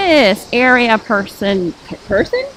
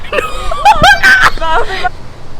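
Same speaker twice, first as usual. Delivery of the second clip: very high squealing laugh; high-pitched laugh. Real, not acted. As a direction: burst into a long, high laugh. Friends joke about signing own book.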